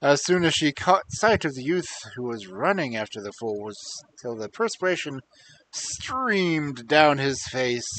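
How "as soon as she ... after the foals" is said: ordinary delivery, nothing marked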